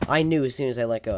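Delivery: normal talking voice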